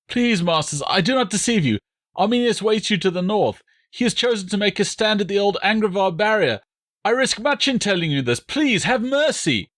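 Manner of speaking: high, frightened begging prisoner voice; pleading deserter. A narrator's reading. Prisoner begging the Roman commanders, high and frightened.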